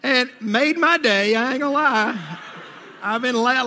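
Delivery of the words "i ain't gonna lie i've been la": talking through real laughter; laughing. Real, not acted. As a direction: chuckle through the words. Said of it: "laughing about it".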